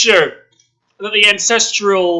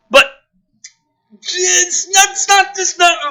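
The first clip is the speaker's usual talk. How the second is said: exasperated shout; 12 semitones higher than usual. Real, not acted. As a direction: shout it can't be. Exasperated outburst at script.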